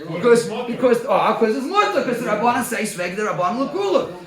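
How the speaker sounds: excited Talmud argument, voicing the man's defense. Real, not acted. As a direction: build up and push the argument. Excited argument, quoting the man.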